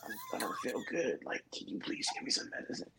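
breathy whisper, thin and high, like too sick to talk; feeble whispery sick voice. Retold or imitated. Sick man pleading with disbelieving girlfriend, faint strained whisper. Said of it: "I said it in my coughing voice".

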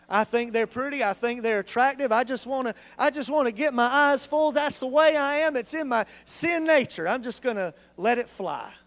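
smug excuse. Retold or imitated. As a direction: ham up a cocky husband justifying himself. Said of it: "what if I just said".

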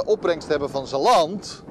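ordinary delivery

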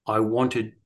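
ordinary talk, nothing marked

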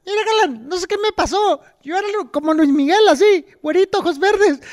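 high-pitched comic impression; mock high voice, played for laughs; Mantecas. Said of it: "Así hablan".

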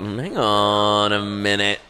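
very slow, stretched-out mock objection; drawn-out dawning realisation. Client realising they pay to play therapist, drawn-out mock objection. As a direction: drag it out as if just catching on.

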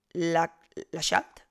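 halting attempt at Laxalt with the X as "sh"; unsure pronunciation. Shown as a demonstration. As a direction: stumble slowly into Laxalt as "la-SHALT". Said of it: "no sé muy bien cómo funciona esa X".

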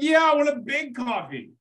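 high, emphatic put-on voice; mock GI repeating his order; insistent American tourist. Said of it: "Americans are like".

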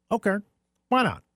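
casual cocky shrug; yuppie voice. Acted. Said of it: "then says".

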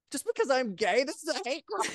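high-pitched, hammed-up outrage; acted-out wronged kid; mock indignant protest. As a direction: protest the unfair blame, voice climbing high. Acted protest at being blamed.

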